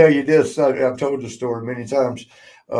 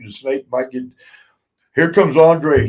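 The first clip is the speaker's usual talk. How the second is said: ordinary delivery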